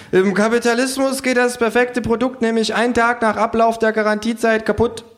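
high kangaroo voice, explaining; lecturing kangaroo. Acted. Kangaroo explaining capitalism to narrator, high lecturing.

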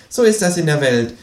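high, indignant kangaroo protest; high, offended kangaroo. Acted. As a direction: whine like a cheated cartoon kangaroo. Kangaroo figure voiced.

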